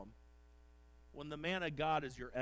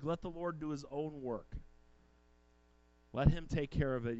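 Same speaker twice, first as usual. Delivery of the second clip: usual speaking voice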